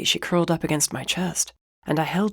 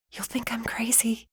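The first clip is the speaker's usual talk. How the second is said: soft, unvoiced whisper; 6 semitones higher, 6 dB quieter than usual. A narrator's reading. Wife confessing to her husband, whispering. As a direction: breathe it out, ashamed to say it. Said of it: "she whispered".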